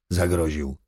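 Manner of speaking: plain reading voice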